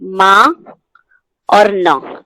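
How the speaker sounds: मा and न said apart, as two separate syllables; syllables spelled out. Shown as a demonstration. Teacher splitting मान into syllables for the class. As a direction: split the word into मा and न. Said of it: "इसके अंदर हमारे दो अक्षर हैं".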